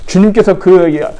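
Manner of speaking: ordinary talk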